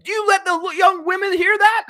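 very high squeal of disbelief; hammed-up shocked question; mock scandalized. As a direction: squeak it in put-on horror, breaking into laughter. Mock shock at swearing before girls.

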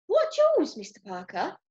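high indignant questions, dipping on the name; demanding. A narrator's reading. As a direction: challenge him with feigned innocence. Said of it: "demanded Granny".

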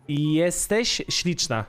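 "jesteś śliczna" said very slowly, every syllable clear; slow Polish demo. Shown as a demonstration. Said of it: "I can learn you something nice".

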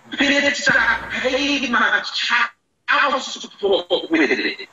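slowed-down replay of the line. Real, not acted. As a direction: drag the line out word by word as if played at half speed. Replayed clip, then slowed.

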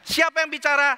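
high, loud rhetorical question. Shown as a demonstration. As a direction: ask it up and loud. Preacher acts self dialogue. (pertanyaan lantang dan tinggi)

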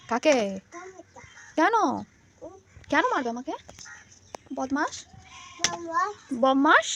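loud, high mock-indignant questions; mock alarm. Real, not acted. Mother playfully shocked at her son's threats.

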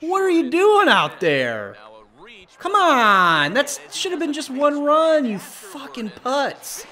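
high, loud exasperated tirade; angry yelling. Real, not acted. Player berating his fielders over a run, angry yelling. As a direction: shout at them in furious disbelief.

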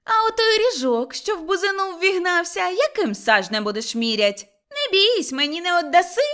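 high, loud, needling questions; quarrelsome challenge. Acted. Motria challenges them over boundary. (задерикувато, високим голосом)

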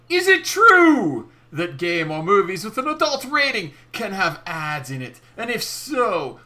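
theatrical read with soaring, punched phrases; dramatic message reading. Acted. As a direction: declaim it grandly, stretching the key words.

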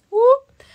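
short, high, loud "whoop"; playful whoop. Real, not acted. Streamer teasing fans about a surprise, sudden high whoop.

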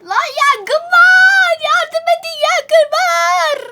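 high, loud drawn-out yelling; hammed-up wailing cry for help; panicked fire alarm. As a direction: scream for help as if trapped by fire. Shouts fire alarm in game.

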